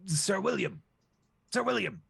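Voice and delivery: high calling out for a servant. Acted. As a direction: summon him up high. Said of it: "the Goose King goes".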